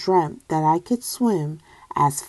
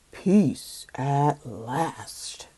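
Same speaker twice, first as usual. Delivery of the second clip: slow, soft whisper; tired dad whispering peace at last; relieved whisper; 5 semitones lower, 7 dB quieter, much slower than usual. Acted. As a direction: breathe it out in relief.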